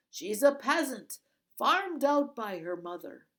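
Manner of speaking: loud, dismissive guard voice; gruff guard. A narrator's reading. Bearded guard argues with the other, loud dismissive. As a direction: wave the other off.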